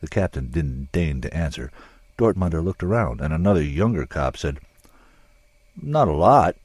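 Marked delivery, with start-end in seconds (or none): 5.8-6.6 a touch defensive, louder. A narrator's reading. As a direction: answer a bit too quickly. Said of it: "but he sounded defensive".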